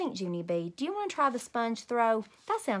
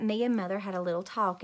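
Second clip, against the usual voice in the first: ordinary delivery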